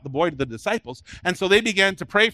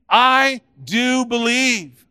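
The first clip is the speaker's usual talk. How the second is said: very slow, raised declaration; leading the congregation; 6 semitones higher, 5 dB louder, much slower than usual. Shown as a demonstration. Preacher asking the congregation to join in, slowly declaiming. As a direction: draw out every word for others to join. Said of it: "Would you say that with me".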